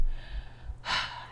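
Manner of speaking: long soft breathy sigh. Real, not acted. Player sighing over the sad dad in the garden, sigh. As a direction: let out a quiet sigh.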